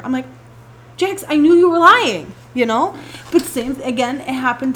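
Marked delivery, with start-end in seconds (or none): 1.0-2.3 retold scolding. Retold scolding of lying child.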